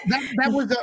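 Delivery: laughing. Real, not acted. Laughing after heavy topic.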